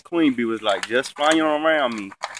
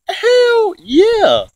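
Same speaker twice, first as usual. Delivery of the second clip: excited; 9 dB louder than usual. Retold or imitated. Retelling his excited reply to dad.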